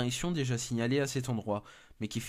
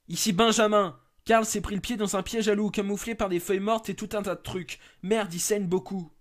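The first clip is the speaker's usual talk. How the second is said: higher, louder alarmed report; alarmed radio call; 10 semitones higher, 7 dB louder than usual. Acted. Benjamin radios base after Karl steps in a trap, alarmed and loud. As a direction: shout it out in a panic, much higher.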